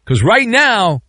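normal talking voice

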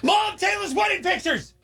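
very high mock shriek; high-pitched re-enacted holler; imitated excited yell. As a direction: imitate a kid yelling for Mom from the bedroom. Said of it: "yells from her bedroom".

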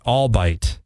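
ALBEIT said "all-bite", two syllables; mispronunciation. Shown as a demonstration. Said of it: "no, no, it's albeit".

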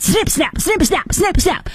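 high singsong snip snap; Michael Scott impression. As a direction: chant it playfully like Michael Scott. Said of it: "I'm like Michael Scott".

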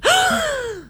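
high breathy gasp of delight; high swoony gasp; swooning gasp. Retold or imitated. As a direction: gasp like you're smitten. Swooning over handsome lifeguard.

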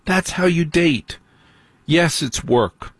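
slow, weighty advice; slow emphatic. Real, not acted. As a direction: spell it out slowly.

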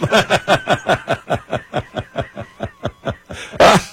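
a long, high, giggling laugh; high burst of laughter. Real, not acted. Cohost cracking up at the socialist plastic joke, laughing high. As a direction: let the laugh spill out high.

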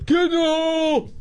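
high, drawn-out heckler holler; mock golf-crowd yell. Co-host mimicking fans who yell at golf tournaments, high mock yell. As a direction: holler it like an obnoxious golf fan. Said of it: "anybody that yells".

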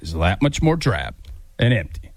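deep narrator voice; Morgan Freeman impression. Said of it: "you need to get quieter and deeper".

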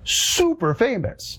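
sarcastic. Real, not acted. Mocking influencer fame.

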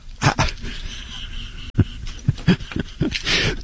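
a long wheezing laugh with no voice; breathy silent laugh. Real, not acted. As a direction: laugh soundlessly in disbelief. Host laughs at her bio.

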